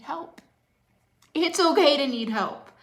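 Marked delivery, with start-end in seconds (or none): 1.3-2.7 high, loud cry of "okay"; a real, fervent shout; passionate shout. Real, not acted. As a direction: belt it out to the back row. Coach repeats, then shouts it.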